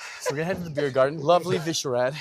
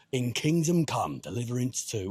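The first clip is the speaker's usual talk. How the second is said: trailer narrator voice; 4 semitones lower than usual. Shown as a demonstration. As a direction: announce it like a game trailer. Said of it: "So do it for him".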